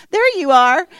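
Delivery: high, loud sing-out on spotting someone; delighted calling out. Real, not acted. Mother spotting Grace in the audience, delighted calling out.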